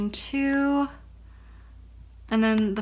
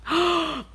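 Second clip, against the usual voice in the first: sharp, high gasp of surprise; high excited intake of breath; delighted gasp; 7 semitones higher than usual. Real, not acted. Sees the poster she got.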